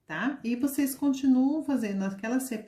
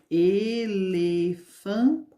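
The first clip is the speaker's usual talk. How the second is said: Elefante drawn out very slowly, each syllable split; slow syllable demo; 4 dB louder, much slower than usual. Shown as a demonstration. Teacher to children breaking elefante into syllables. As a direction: count the syllables with your voice. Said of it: "Então, tem quatro sílabas".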